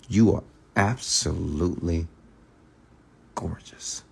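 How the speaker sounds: slow, with gorgeous whispered soft; pause, then breathe gorgeous; slow intimate whisper. Shown as a demonstration. Coach demonstrating a pickup line, slow whisper. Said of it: "you gotta be slow about it".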